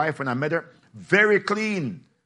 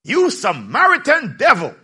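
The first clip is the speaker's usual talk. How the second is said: retort; 6 semitones higher, 4 dB louder than usual. Acted. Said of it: "The people retorted".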